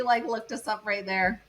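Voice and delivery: ordinary talk, nothing marked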